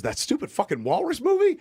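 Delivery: high-pitched mock disbelief; imitating an astonished listener; incredulous. Retold assistant's incredulous reply.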